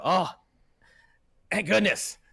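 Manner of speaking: high, loud relieved cry; startled dwarf, suddenly relieved; relieved exclamation. Acted. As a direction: sound glad the help has come. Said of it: "they go".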